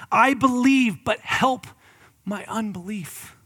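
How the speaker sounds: loud, high cry, fading to a slow plea. Acted. Father crying out to Jesus, loud then softening. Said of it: "he cries out".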